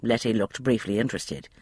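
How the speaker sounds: usual speaking voice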